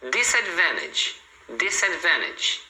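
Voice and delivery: disadvantage as "disadvenedge", drawn out; natural dropped T. Shown as a demonstration. Teacher giving another example, disadvantage without T. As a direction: slowly say disadvantage with no T. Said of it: "a pronúncia natural comum".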